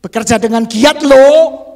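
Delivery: loud, drawn-out demand; exaggerated pointed command; marked intonation. Shown as a demonstration. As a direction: push it out forcefully, stretching the end. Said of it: "Nggak usah ditandai intonasi kan ya".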